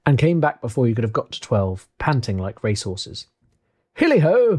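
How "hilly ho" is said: high, loud jovial holler; Fezziwig. Acted. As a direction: sing out a hearty hail to the lads. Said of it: "cried old Fezziwig".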